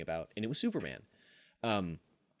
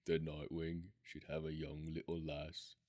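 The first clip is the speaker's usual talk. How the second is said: Alan Moore impression; 5 semitones lower, slower than usual. Host pitches the comic as Alan Moore, low British accent. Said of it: "my least good Alan Moore".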